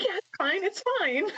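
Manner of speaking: high-pitched nervous "it's fine"; high nervous reassurance. Retold or imitated. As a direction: squeak it out like a nervous teen. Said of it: "She's like".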